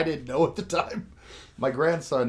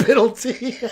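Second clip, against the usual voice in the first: ordinary talk, nothing marked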